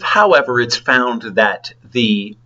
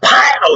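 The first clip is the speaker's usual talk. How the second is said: a sharp, high, louder "pow"; mock punch sound; 12 semitones higher, 3 dB louder than usual. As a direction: snap out the punch noise like a cartoon. Retelling a punch in the face.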